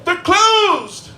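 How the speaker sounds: high, loud mock wail; hammed-up stepdaughter holler, last word stretched; imitated stepdaughter wail. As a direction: cry it out in an exaggerated high voice. Acted stepdaughter crying out at closed restaurant.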